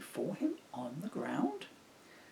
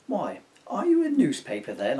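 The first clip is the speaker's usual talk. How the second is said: cheeky joke; 3 semitones higher, 10 dB louder than usual. Acted. Bill tells a joke.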